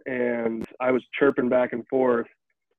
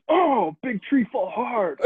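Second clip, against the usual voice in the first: high, slow caveman talk; mock caveman taunt; 10 semitones higher, slower than usual. Opponent under the net mocking the fallen player, slow high caveman voice. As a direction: drag it out like a dumb giant, teasing. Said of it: "comes under the net and goes".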